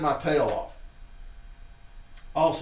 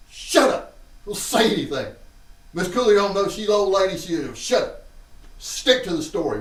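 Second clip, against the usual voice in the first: bossy sister; 6 semitones higher, 5 dB louder than usual. Retold or imitated. Sister scolding him.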